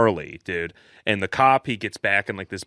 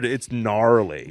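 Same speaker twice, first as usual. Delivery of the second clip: ordinary delivery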